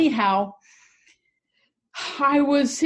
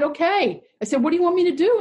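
higher and louder, an eager question to God; eager reply; 9 semitones higher, 5 dB louder, much faster than usual. Retold or imitated. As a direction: ask it keenly, pitch rising.

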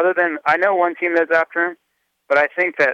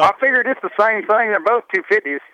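put-on Kentucky accent; 7 semitones higher than usual. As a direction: mimic a Kentucky rider. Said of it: "he figured in his Kentucky accent".

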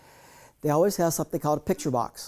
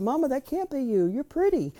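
put-on much higher little boy's plea; child protesting; 10 semitones higher than usual. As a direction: protest like a small boy near tears. Child upset at mother's photo.

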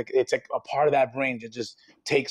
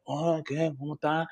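softly imitated Dominican greeting; Dominican accent; 7 dB quieter than usual. Imitating Dominicans for the interviewer, soft Dominican accent. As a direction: slip quietly into a Dominican accent. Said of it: "there are Dominicans that are like".

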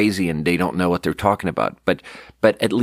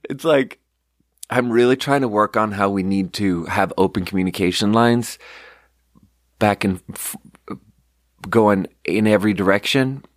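ordinary talk

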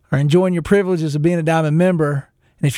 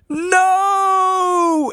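much higher, a retold cry of dismay. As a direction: wail "No!" up high as the phone hangs up. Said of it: "and I just go".

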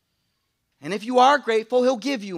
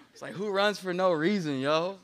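laughing; 3 semitones lower, 4 dB quieter than usual. Retold or imitated. Mocking suburbs, laughing.

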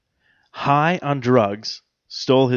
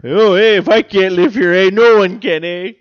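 put-on Canadian accent; 6 semitones higher, 7 dB louder than usual. Mock evicted Canadian.

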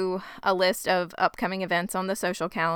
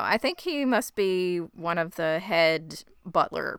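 ordinary talk, nothing marked

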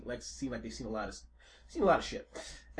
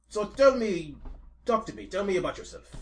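pushy NPC; 8 semitones higher, 9 dB louder than usual. Acted. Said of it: "He's like".

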